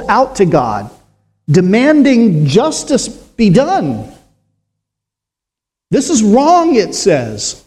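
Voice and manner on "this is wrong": raised pitch, pleading accusation; voice of sin. Acted. Preacher voicing sin calling to God. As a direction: cry out as the wronged money itself. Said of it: "Stolen money has a voice".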